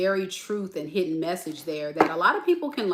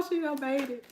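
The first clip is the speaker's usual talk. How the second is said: higher but softer, choked repeat; teary hush; 6 semitones higher, 6 dB quieter than usual. Retold or imitated. Fan overcome, softly repeating through tears. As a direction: say it again gently through tears. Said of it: "I was like".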